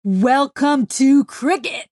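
loud, slow, hearty call. A narrator's reading. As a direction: call out a welcome across the distance. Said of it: "Bear called out".

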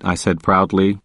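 usual speaking voice

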